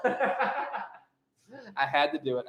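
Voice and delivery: slow, laughing through the words. Real, not acted. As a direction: giggle as you drag it out. Repeating joke, laughing.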